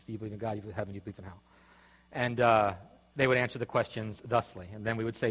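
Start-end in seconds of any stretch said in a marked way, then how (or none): 0.0-1.4 fading to quiet. Shown as a demonstration. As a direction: start soft and fade almost to nothing. Repeats questions, fading away.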